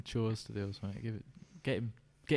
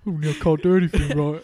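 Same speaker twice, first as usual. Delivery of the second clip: mock whining husband; 2 semitones higher, 10 dB louder than usual. Mocking the sacked husband.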